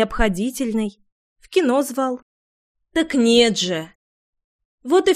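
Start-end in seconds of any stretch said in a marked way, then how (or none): none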